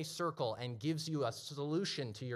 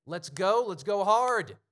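loud, pumped, higher rallying cry; hyped-up shout; 7 semitones higher, 12 dB louder, slower than usual. Retold or imitated. Young men hyping each other up after the message, loud excited shout. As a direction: fire the guys up, getting louder. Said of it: "being like".

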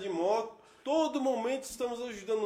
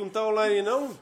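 ordinary talk, nothing marked

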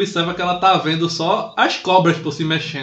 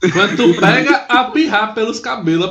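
ordinary delivery

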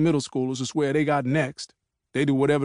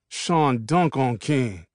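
ordinary delivery, nothing marked

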